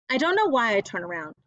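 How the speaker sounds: plain reading voice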